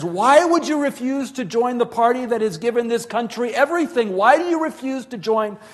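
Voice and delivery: acted-out shouting, very high and loud. Official demanding why she refused the party, aggravated shouting. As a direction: shout it like an official at the end of his patience. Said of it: "he started raising his voice".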